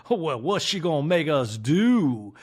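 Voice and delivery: drawn-out put-on worry; slow, hammed-up impression of fretting; Sutton. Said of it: "And Sutton's like".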